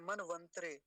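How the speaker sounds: "मनवंतरे" ending in a squeezed "त्रे"; mistaken pronunciation. Shown as a demonstration. Teacher showing what people get wrong, half त. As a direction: say "मनवंतरे" with half त, no vowel before रे. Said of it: "जो गलत करते हैं".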